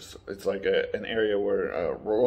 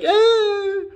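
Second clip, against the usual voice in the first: very high, loud mock squeal; imitated child's wail; 7 dB louder than usual. Child thinks Mando is yelling, imitated high wail. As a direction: wail like a startled little creature. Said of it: "So he's like".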